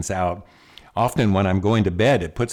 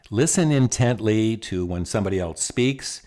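ordinary talk, nothing marked

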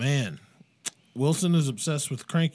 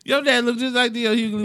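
excited roast; 10 semitones higher than usual. Real, not acted. Keeps roasting friend's dad.

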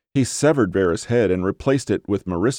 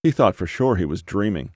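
ordinary delivery, nothing marked

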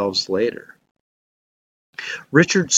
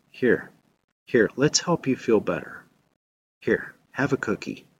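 softer, coaxing and gentle; soothing voice; 5 dB quieter than usual. Acted. The soothing inner voice coaxing you, softly. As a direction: offer it like comfort to a hurt child. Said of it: "the soothing voice".

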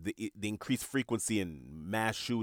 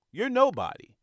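much higher, louder, sniffy dismissal; the ego looking down its nose at everyone; Tim Gunn ego voice; 5 dB louder than usual. Retold or imitated. Host voicing his own ego sneering at others, high and haughty. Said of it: "He sounds sort of like Tim Gunn".

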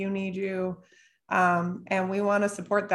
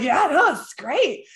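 higher, louder, slow and sure; enthusiastic client; 6 semitones higher, 4 dB louder than usual. Retold or imitated. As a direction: gush that it works. Client reassuring her.

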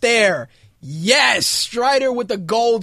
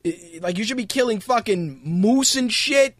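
normal talking voice

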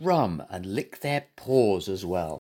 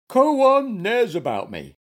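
high, loud, indignant bear voice; angry cry; 10 semitones higher, 6 dB louder than usual. A narrator's reading. Bear answers being scolded, high angry cry. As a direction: protest crossly, raised in pitch and volume. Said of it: "cried Goompa angrily".

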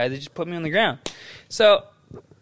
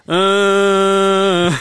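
mock dying groan; 4 dB louder than usual. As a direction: groan like a bad actor playing wounded. Imitating dying Melvin.

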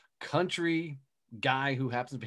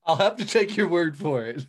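louder, laughing through the words; laughing reply; 6 dB louder than usual. Real, not acted. Host answers the pantyhose joke, laughing. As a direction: tease her back while giggling.

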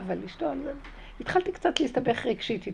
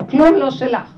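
כלום לא שלך shouted much louder; 3 semitones higher, 13 dB louder than usual. Acted. Her inner voice shouting the truth at her, loud. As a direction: belt כלום לא שלך out at full voice. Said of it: "זה לא שלך בכלל".

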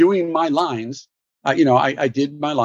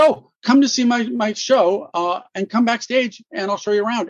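much higher, bright invite; eager invitation; 8 semitones higher than usual. Retold or imitated. Lithgow inviting the young actor backstage, much higher and eager. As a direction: warmly insist they come backstage. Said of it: "he said".

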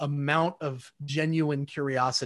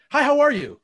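bright, much higher, louder quick hello; breezy rhetorical greeting; 11 semitones higher, 6 dB louder than usual. Retold or imitated. Salesman skipping past the greeting to get to the deal, breezy and high. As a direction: toss off the greeting without wanting an answer. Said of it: "curiosity with a means to an end".

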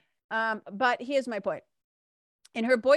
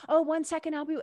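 higher-pitched, lightly harried clerk; a little frazzled; 7 semitones higher, faster than usual. Retold or imitated. Busy shop girl to waiting customer, a little frazzled. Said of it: "she's a little frazzled".

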